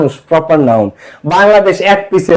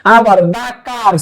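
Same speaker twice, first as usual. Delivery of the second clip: ordinary talk, nothing marked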